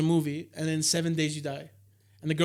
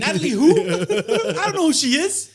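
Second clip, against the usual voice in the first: loud, high-pitched mock ignorance; panicked denial; 9 dB louder than usual. Joking as a husband fooling a jealous ghost, loud panicked denial. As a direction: play dumb at a raised, squeaky pitch. Acted husband playing dumb about a woman.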